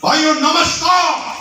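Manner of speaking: hammed-up seminar host yelling hello; mock stage shout. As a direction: bellow it like a hyped stage host. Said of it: "वो तेज तेज चिल्ला".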